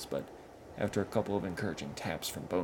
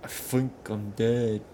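dazed troll; 5 semitones higher, 10 dB louder, slower than usual. Acted. Dead troll speaks, dazed.

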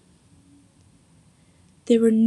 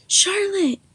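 a sudden, much higher cry of the name; anguished cry; 10 semitones higher than usual. A narrator's reading. Narrator crying out on finding her friend, high and anguished. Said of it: "I cried".

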